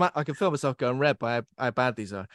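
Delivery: usual speaking voice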